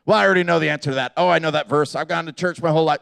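rapid-fire mock know-it-all; hurried, dismissive imitation of a churchgoer; blurted quick answers. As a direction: rattle it off fast, brushing the listener off. Mimicking people blurting quick answers.

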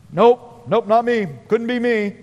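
short, firm refusals; brisk denial. Acted. Peter dodging the little girl's accusation, brisk denial. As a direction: shut the accusation down evasively.

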